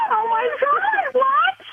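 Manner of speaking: a high, shrieking laugh of disbelief, drawn-out oh my God; shocked shriek. Real, not acted. Prank victim hears she was phone tapped, shrieking laugh.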